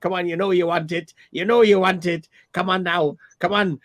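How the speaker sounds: mock Irish old lady pushing her luck; Irish old woman. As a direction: wheedle it like an old Irish granny. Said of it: "And she was Irish as well".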